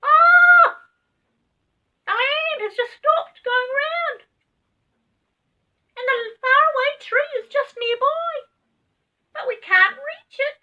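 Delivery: very high, loud rabbit cry; slow, loud, squealing call; rabbit shouting. A narrator's reading. Rabbit shouts from the hole, very high and loud. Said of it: "gave a shout".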